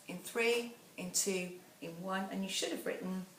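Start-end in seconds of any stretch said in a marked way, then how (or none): none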